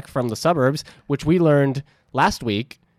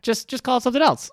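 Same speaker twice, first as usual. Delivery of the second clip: pitched way up in mock despair; squeaky high voice; 12 semitones higher, faster than usual. Mock exasperated plea.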